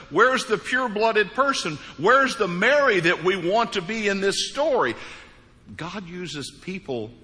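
normal talking voice

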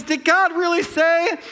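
high, slow, rising question; Satan's questioning. Retold or imitated. Satan planting doubt in Eve, slow questioning. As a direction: draw out the doubt as the tempter. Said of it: "Satan comes around and says".

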